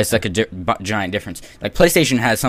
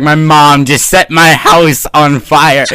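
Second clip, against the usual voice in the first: slow, loud, high; loud slow fake excuse; mock shouted excuse; 4 semitones higher, 10 dB louder, much slower than usual. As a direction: drag out a ridiculous lie loudly. Mock absurd excuse, shouted slowly.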